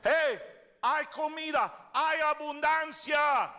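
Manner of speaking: high, slow shout across the city. Acted. Lepers shout to the city about food, high slow shout. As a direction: call out the news of food. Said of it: "ellos gritaron".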